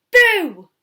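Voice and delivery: shouted playful boo; loud boo. Acted. Cub scaring the sunbathing walrus with a loud boo. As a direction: jump out and yell it.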